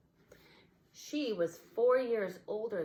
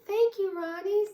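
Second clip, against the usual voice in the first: much higher, louder toy voice; 14 semitones higher, 9 dB louder than usual. A narrator's reading. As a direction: chirp your thanks. Toy thanking.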